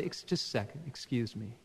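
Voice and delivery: a quiet, courteous interruption; polite quiet aside. Retold or imitated. Pastor quietly excusing himself from adults to greet a child, softly. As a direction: softly excuse yourself mid-talk. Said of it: "And I'll say".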